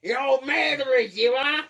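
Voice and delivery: lightly voiced mock old man shout; imitating old man voice. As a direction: parrot the old man yell. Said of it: "a fucking old man voice".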